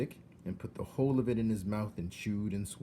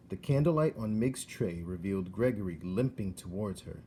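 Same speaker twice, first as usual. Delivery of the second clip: plain reading voice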